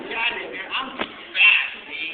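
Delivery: put-on loud, cocky kid's brag; shouting a cocky brag at full volume; cocky boast. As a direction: crow that you're too fast, loud and slow. Boasting kid running off.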